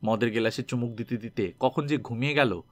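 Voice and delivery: ordinary delivery, nothing marked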